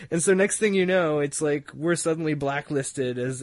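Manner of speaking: ordinary delivery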